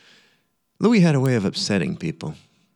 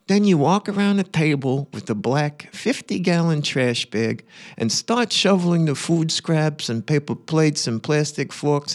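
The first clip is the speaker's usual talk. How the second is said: Uncle Louis. Acted. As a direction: put on an opinionated uncle's voice, pitched up and emphatic. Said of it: "read it in Uncle Louis's voice".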